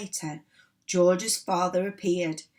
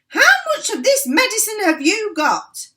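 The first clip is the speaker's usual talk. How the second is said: high, loud eager question; eager shout; 13 semitones higher, 10 dB louder than usual. A narrator's reading. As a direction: demand it excitedly. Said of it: "Mister Cranky shouted".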